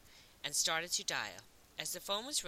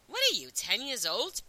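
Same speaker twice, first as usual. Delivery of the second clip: loud, higher, dismissive question; unimpressed; 6 semitones higher, 6 dB louder than usual. A narrator's reading. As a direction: brush off the kid's compliment loudly. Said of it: "didn't sound impressed by the compliment".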